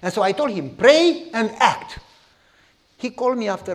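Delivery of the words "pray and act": slow, loud, deliberate; firm slow command. Retold or imitated. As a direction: lay it down word by word. Pastor's emphatic advice to man.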